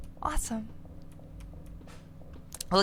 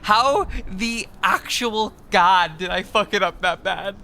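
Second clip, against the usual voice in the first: louder exasperated self-scolding; frustrated outburst; 5 dB louder than usual. Real, not acted. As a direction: berate yourself loudly in disbelief. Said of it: "self-frustrated outburst".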